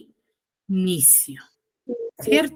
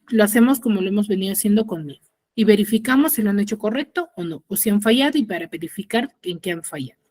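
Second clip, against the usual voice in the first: rushed; much faster than usual. Real, not acted. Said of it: "rushed instructions".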